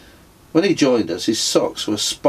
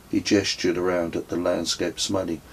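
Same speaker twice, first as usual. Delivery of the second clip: usual speaking voice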